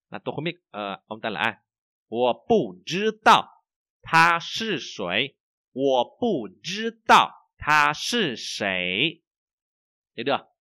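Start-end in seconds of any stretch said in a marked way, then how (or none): 2.1-9.2 slow, loud, clear Chinese to repeat after; slow model reading. Shown as a demonstration. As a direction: read it out slowly for students to echo.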